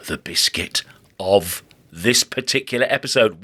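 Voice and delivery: hushed secretive whisper. Pretend. Whispering the big biscuit reveal to listeners.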